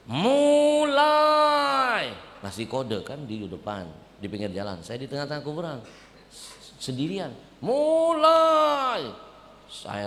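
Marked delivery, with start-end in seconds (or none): 0.1-2.1 high, loud call across the graveyard; shout from afar. Retold or imitated. Teacher shouting the start from the roadside, loud. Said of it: "Guru saya dari jauh teriak". 7.6-9.3 high, loud call across the graveyard; shout from afar. Retold or imitated. Teacher shouting the start from the roadside, loud. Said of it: "Guru saya dari jauh teriak".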